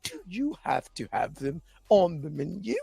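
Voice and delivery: playing a polite Brit ordering wings; mock British accent. Asking a waiter for lemon pepper wings in mock British accent. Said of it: "I want the proper British".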